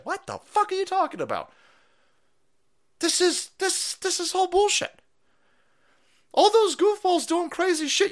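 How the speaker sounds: high squeaky disbelief; mock outraged liberal. Mocking outraged media voices, high squeal. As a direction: squeal it in fake indignation.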